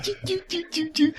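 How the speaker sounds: tiny, high, soft choo-choo sneaking sounds; stealthy tiptoe noises. Retold or imitated. Podcaster mocking his friend's childhood stealth, high soft sneaky noises. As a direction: imitate sneaking with little high squeaks. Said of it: "Just, like, stealth".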